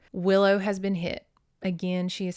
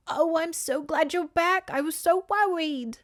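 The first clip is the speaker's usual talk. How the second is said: put-on high, worried old aunt voice; a much higher voice for the worried great aunt; fretful old aunt; 9 semitones higher than usual. Great Aunt Pip voiced in book.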